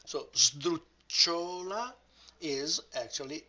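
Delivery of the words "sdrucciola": "sdrucciola" slow and loud, stressed SDRUC-cio-la; loud, slow teacher's model of "sdrucciola", front stress; stress on third-last syllable. Shown as a demonstration. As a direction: stretch out "sdrucciola", hitting "sdruc". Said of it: "stress goes on the third to the last".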